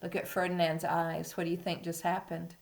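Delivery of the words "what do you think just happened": ordinary delivery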